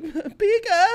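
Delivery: high, loud little creature cry; Pikachu. Retold or imitated. Acting out a cartoon creature crying over a friend turned to stone, high loud cry. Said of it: "really are good at impressions".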